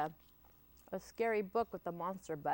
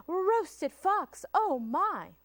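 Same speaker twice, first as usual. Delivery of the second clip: high, louder alarmed cry; frightened fox; 11 semitones higher, 8 dB louder, much slower than usual. Acted. The fox, scared of the gruffalo, cries out high and loud. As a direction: yelp it in fright.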